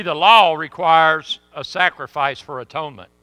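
ordinary delivery

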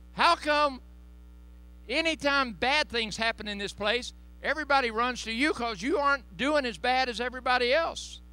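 a coworker wondering aloud, high-pitched; high questioning coworker. Retold or imitated. Coworker asking, voiced high.

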